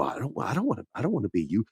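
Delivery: a silent lip-read protest; mouthed silently. Retold or imitated. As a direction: mouth the words with almost no sound. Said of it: "you see the lips go".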